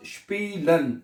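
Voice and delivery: स्पीलन said with a clear "shp" onset, shpee-len; German pronunciation demo. Shown as a demonstration. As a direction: model the word स्पीलन slowly as "shpeelen". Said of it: "एसपी वाले लफ्ज".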